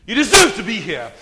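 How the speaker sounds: loud, high-pitched accusing voice; menacing demon voice. Retold or imitated. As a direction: sneer it like a tormentor. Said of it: "Another man to my left said".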